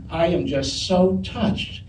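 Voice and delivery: slow, softly spoken mock sincerity; imitated heartfelt thanks. Co-star thanks him for the rose, imitated slow sincerity. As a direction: draw out each word as if deeply moved. Co-star insists she is touched.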